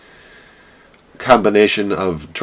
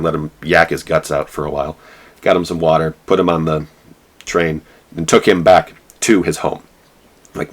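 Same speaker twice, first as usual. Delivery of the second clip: ordinary talk, nothing marked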